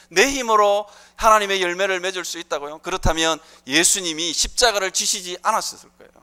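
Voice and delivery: ordinary delivery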